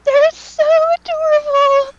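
high, delighted fangirl gush; overwhelmed by cuteness, squealing high. Real, not acted. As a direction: squeal at how adorable they are. Gushing over child photos.

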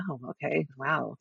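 quiet stunned aside. Retold or imitated. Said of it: "And I'm like".

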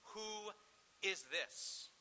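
slow, halting disbelief with a pause after "who"; retold terrified awe. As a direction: imitate awestruck disciples. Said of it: "it says they were terrified".

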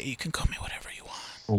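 playful breathy whisper. Pretend. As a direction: whisper it teasingly. Teasing answer after name mix-up.

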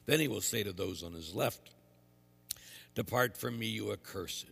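measured, solemn proclamation; quiet liturgical reading; gospel reading. Acted. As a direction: read scripture aloud at the altar.

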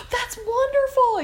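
high, loud and slow, beaming; delighted reply. Retold or imitated. Doctor hearing the husband lived, high, loud and delighted. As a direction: savour it with real joy. Doctor reacts to good news.

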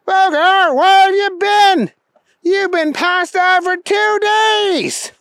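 high-pitched, loud exclaiming; exasperated buddy. A narrator's reading. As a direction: holler at your friend, stretching the last words. Keith exclaims at waking Booger.

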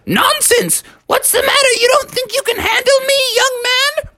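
very high, feisty granny voice; high-pitched old-lady scolding; indignant grandma. Acted. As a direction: challenge them as a scrappy cartoon grandma. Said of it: "And she said".

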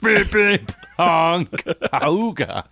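honking horn noises with the mouth; goofy car horn sound effects; silly clown. Pretend. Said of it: "I could have been that guy".

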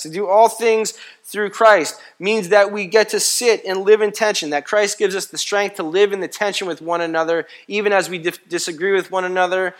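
loud, high, driving preacher's cadence; preaching at full force. Acted. Pastor rousing the congregation, full-force preaching. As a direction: hammer each not about home to the church. Preacher drives the point again.

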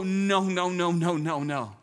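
mock panicked wail, sliding down; imitated toddler sob. Dad retelling his two-year-old daughter's panic, imitated sobbing. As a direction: imitate a little child's sobbing protest. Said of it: "She starts to sob".

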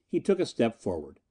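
ordinary delivery

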